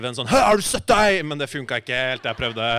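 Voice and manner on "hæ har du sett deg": loud, high aggressive thug bark; aggressive Taxi Driver. Retold or imitated. Rehearsing in the mirror, aggressive Taxi Driver style. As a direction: spit it out like a street tough. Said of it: "øvde litt sånn Taxi Driver-aktig, ... en aggressiv".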